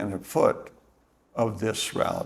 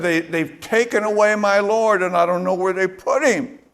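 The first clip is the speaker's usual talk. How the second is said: higher, louder, rushed frantic plea; 6 semitones higher, 3 dB louder than usual. Retold or imitated. Mary frantic to the angels, higher, louder and hurried. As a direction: blurt it out in panic, voice up high. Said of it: "And she is just frantic".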